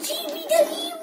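excited squeal. Real, not acted. Excited squeal at bird transformation.

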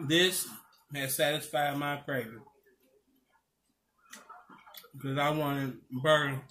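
eating between words; chewing while talking. Real, not acted. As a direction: mouth partly full of food. Seen 0: Takes forkfuls to mouth, closed-mouth chewing between words throughout.

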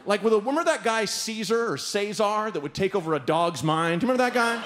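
ordinary talk

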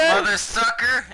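high slow angry yell; frustrated yell. Real, not acted. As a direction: curse out loud. Frustrated at dying.

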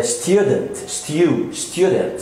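STUDENT as "styu-dent", deliberately shown; British student, "styu". Shown as a demonstration. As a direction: put a Y glide in student. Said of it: "في البريتش إنجليش".

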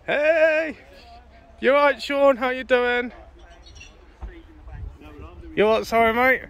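loud, high greeting across the car park; calling out. Real, not acted. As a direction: shout a cheery hello to a mate. Calling out to a friend.

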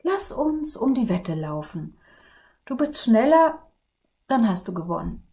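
a hint of weary dragon, playful; sigh, then suggest the race; lightly voiced dragon. Dragon talking the prince into a race, lightly voiced.

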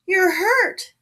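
high, loud cry of alarm; startled, piercingly loud exclamation; sudden cry. A narrator's reading. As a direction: cry out in shock at an injury. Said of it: "There was a sudden cry".

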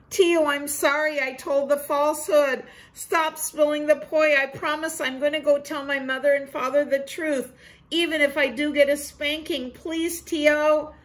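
put-on boy Ko pleading with Tio to stop; pleading little boy. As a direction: beg like a sorry kid.

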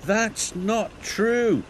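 high, drawn-out indignant protest; cross little engine, high and slow. A narrator's reading. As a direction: protest crossly, pitch leaping up. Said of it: "said Thomas crossly".

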